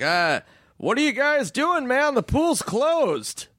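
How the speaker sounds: high, slow dopey guard voice; drawn-out sleepy guard; mock security guard. Guard telling them the pool's closed, dopey and slow.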